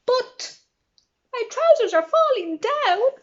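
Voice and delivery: high, loud startled cry; sudden alarm. A narrator's reading. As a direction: yelp as the trousers drop. Trousers falling, alarm.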